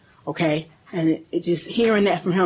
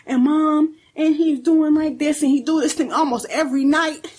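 put-on higher, pouring it out like a small child; lightly voiced little boy telling mom everything; voicing young son; 8 semitones higher than usual. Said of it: "Matthew at this point is just spilling out".

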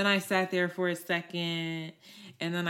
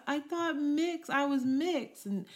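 put-on higher, earnest little-kid voice; child explaining; 8 semitones higher than usual. Girl explaining to her teacher, high child voice.

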